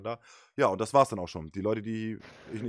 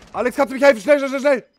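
high, loud urgent begging; panicked plea; 16 dB louder than usual. Real, not acted. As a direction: call for help in a panic. Downed, begging for help.

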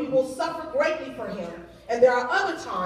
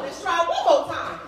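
usual speaking voice

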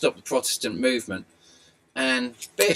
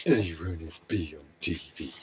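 lower, softer cooing to the dog; dog owner cooing back at the puppy, low and soft; soft pet talk; 8 semitones lower, 5 dB quieter than usual. Real, not acted. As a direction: coo it gently to the puppy.

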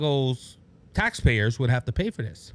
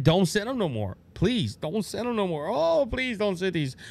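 mock begging, rising higher and slowing on the last plea; mock pleading; 6 semitones higher than usual. Mayor begging not to be sent more migrants, mock pleading. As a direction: plead with them to stop, half in jest. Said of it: "different tones when the problem is in your backyard".

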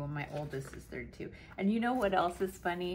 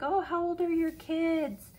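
imitating strangers; 11 semitones higher, 5 dB louder than usual. Strangers asking about kids.

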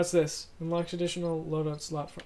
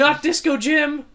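high, loud, slow theatrical grief; dramatic wail; 8 semitones higher, 14 dB louder, slower than usual. Acted. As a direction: mourn him like a tragedy. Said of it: "That's really dramatic".

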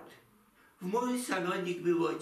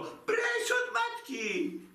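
a high, slow, outraged cry; indignant cry; much slower than usual. Acted. Daughter defending her mother from the count, a high slow outraged cry. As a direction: fling it at him high and slowly in outrage. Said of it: "krzyknęło".

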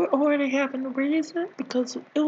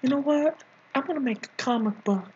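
ordinary talk, nothing marked

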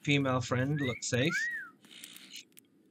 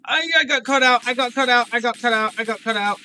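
high, loud panic; frantic high-pitched shouting; panicked yelling; 11 semitones higher, 10 dB louder than usual. Real, not acted. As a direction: yell it over and over in alarm. Spotted while sneaking in game.

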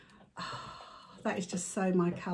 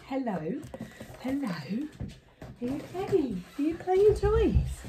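higher sing-song to the pet; dog-talk voice; 5 semitones higher than usual. Real, not acted. Owner asking Milo about his toys, high baby talk. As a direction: coo at the puppy.

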